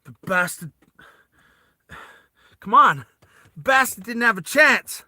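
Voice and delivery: Vas having a breakdown. Acted. Vas reacts to his comrade's death, distraught outburst. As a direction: break down in disbelief, voice rising. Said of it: "Vas has a breakdown".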